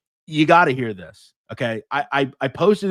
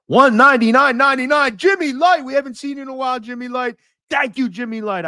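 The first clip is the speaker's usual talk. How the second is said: excited donor shout-out; 11 semitones higher than usual. Real, not acted. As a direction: hail a returning supporter in a high voice. Greets a donor on live stream.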